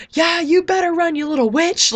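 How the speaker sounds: high, loud gleeful taunt; gloating cheer. Retold or imitated. Vlogger retelling her cheer at the villain fleeing, loud high gloating taunt. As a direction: cheer on the screen like a rowdy fan.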